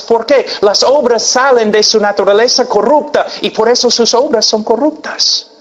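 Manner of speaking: ordinary talk, nothing marked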